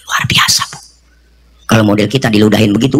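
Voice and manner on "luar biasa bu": hushed, breath-only amazement; voiceless murmur of admiration; awed whisper. Real, not acted. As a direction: whisper it in wonder. Awed comment after the conversion.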